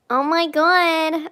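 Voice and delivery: slow dramatic groan; exasperated. Real, not acted. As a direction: drag it out in dismay.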